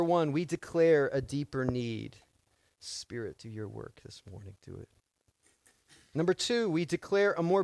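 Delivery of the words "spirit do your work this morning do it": soft, low murmured prayer; quiet sincere appeal to the Spirit; hushed prayer. Real, not acted. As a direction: drop into a whispered plea.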